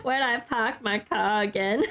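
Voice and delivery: put-on slow Boston accent, dropping the r's; amused attempt at a Boston accent. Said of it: "I do like the Boston accent".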